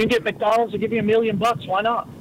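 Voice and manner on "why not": ordinary delivery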